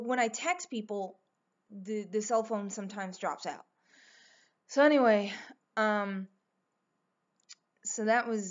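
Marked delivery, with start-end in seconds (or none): none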